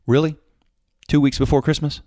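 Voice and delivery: high, loud disbelief: really?; incredulous retort. Retold or imitated. Owner brushing off the visiting rep, loud and incredulous.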